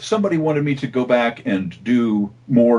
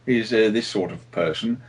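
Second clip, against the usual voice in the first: Australian sniper accent; 4 dB quieter than usual. Shown as a demonstration. Voice actor demoing the Australian sniper, a light Australian accent. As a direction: ease into a laid-back Australian accent. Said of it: "and he talks like this".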